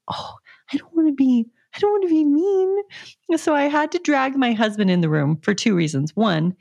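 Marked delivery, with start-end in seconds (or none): 0.1-2.8 worried. Retold or imitated. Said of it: "I felt like".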